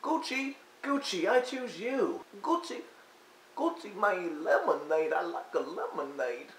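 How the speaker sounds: high, slow Pokemon-style chant of his own name; [name] impression. Mocking [name] as a Pokemon, high slow squeak. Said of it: "all he does is go".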